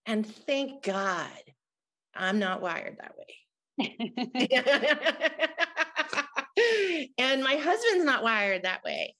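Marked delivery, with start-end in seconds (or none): 3.8-7.1 high, bright burst of laughter; defiant laugh. Real, not acted. Telling the host she's not wired to give up, laughing. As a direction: laugh it off, proud of her stubbornness.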